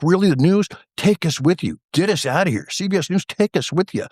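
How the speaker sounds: high, excited mock begging; psyched plea. Prisoner begs CBS News to take them along, psyched. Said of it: "I'm just psyched".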